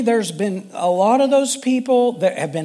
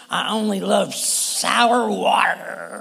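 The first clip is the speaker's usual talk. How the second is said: slow, distorted sour-faced voice; mock sourness; slower than usual. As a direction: say it with a sour pucker, dragging the words. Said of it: "doesn't look very much like".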